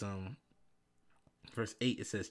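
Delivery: ordinary talk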